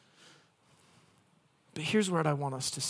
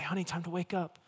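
put-on soft, tender voice to a sleeping child; gentle wake-up call; 7 dB quieter, faster than usual. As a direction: coax a child awake gently. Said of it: "rub their back gently and say".

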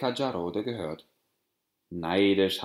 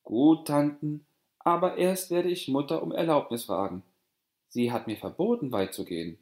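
put-on higher, louder girl's voice; young girl Periapokak; 6 semitones higher, 3 dB louder than usual. A narrator's reading. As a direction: agree but mention mother's rule. Periapokak answers aunts.

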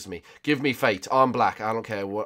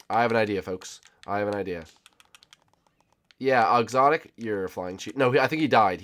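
ordinary talk, nothing marked

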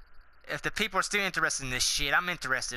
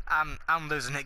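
normal talking voice

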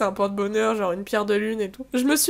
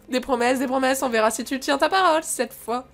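ordinary talk

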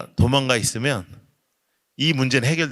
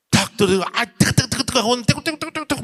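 burning hot; 10 semitones higher than usual. Retold or imitated. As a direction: cry out as if burned by fire. Burning fire falls on him.

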